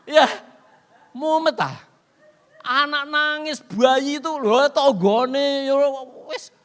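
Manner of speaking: preacher complaining about the noisy village, loud; exasperated exclamation. Acted. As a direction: complain loudly about the crying neighbours. Crowded village noise, exclaiming.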